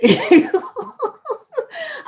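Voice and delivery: high burst of real laughter; bright real laughing fit; big laugh. Real, not acted. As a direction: laugh out loud at the joke. Laughing at chat joke.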